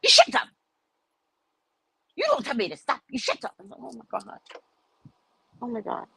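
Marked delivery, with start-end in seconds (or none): 0.0-3.5 high-pitched imitation of an irate woman; acted-out bus quarrel; mock angry retort. 3.8-6.0 very soft, retold aside; hushed mock dismay; muttered disbelief. Retold muttered reaction to the woman.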